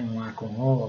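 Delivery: ordinary talk, nothing marked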